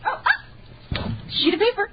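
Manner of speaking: ordinary delivery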